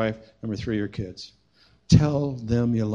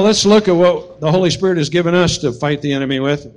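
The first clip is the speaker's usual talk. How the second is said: ordinary talk, nothing marked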